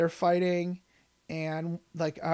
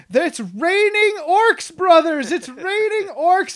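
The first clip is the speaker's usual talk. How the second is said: high, loud, drawn-out acted glee; gleeful battle cry; 10 semitones higher, 10 dB louder, slower than usual. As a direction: cry out to the troops in mock jubilation. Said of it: "hands in glee, saying".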